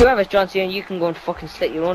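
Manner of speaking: panicking gamer. Real, not acted. Raging gamer losing a match.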